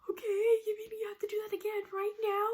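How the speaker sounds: high-pitched, rushed tearful whine; mock crying. Crying patient to nurse about a second needle, mock sobbing. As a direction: imitate bawling in disbelief. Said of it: "crying my eyes out".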